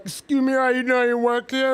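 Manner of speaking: mock polite shopper. Imitating a shopper flagging down a Walmart worker, high and loud. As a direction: pipe up brightly to flag an employee.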